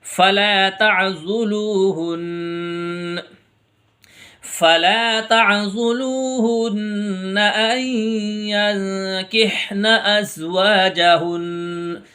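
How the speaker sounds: slow, drawn-out melodic tajweed chant; Quran recitation. Acted. Teacher reciting verses for students.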